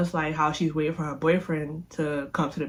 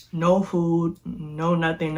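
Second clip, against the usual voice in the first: usual speaking voice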